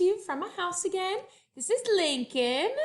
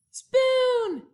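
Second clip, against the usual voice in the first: dramatic reveal; 9 semitones higher, 6 dB louder than usual. Acted.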